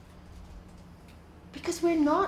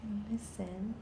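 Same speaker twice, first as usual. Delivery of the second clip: guided meditation; 3 semitones lower, 10 dB quieter than usual. Acted. Teacher guiding listeners through breathing, softly. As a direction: lead them gently into stillness.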